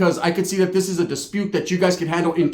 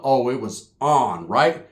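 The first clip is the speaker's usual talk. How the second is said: ordinary talk, nothing marked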